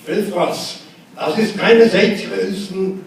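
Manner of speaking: loud, high granddad yelling in German; loud German scolding, sending a child to bed; mock German yelling. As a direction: bark it like an angry old granddad. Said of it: "he sometimes yelled at me".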